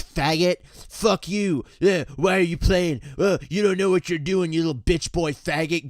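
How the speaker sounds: abusive gamer bully. Retold or imitated. Male gamer harassing a high-voiced kid on voice chat, abusive jeering. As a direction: imitate a gamer hurling insults.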